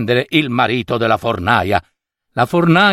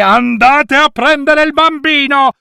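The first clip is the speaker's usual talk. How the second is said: much higher, louder thundering command; 12 semitones higher, 4 dB louder than usual. A narrator's reading. Goblin king interrupting the baker, thundering. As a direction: boom it out, cutting her off. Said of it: "tuonò il re dei folletti".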